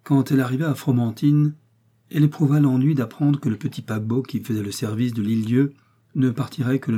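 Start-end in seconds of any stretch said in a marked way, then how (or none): none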